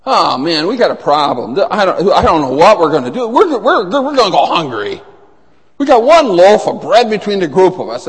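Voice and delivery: loud, worried mock panic over lunch. Disciples panicking over bread.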